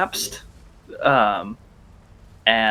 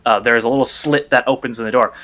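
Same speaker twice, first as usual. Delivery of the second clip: normal talking voice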